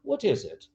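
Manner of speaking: soft question starting high on WHAT; changed intonation. Shown as a demonstration. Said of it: "changing intonation and changing a bit, uh, the emphasis".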